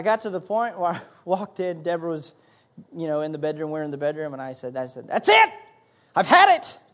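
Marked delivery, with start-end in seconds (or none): 5.1-6.8 much higher, loud fed-up outcry; throwing up his hands in anger; frustrated outburst. Retold or imitated. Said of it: "And I was frustrated".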